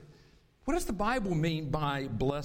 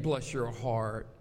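"Bless your heart." lower and slow, Southern drawl; Southern saying; 8 semitones lower, slower than usual. Shown as a demonstration. Pastor quoting the North Carolina saying, low and slow Southern. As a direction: give it the Southern lilt. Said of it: "we have a saying".

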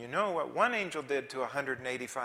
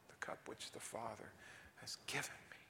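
hushed whisper of the verse; 3 semitones lower, 13 dB quieter than usual. Acted. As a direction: breathe it softly. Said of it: "hushed reading of the verse".

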